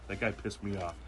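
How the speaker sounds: normal talking voice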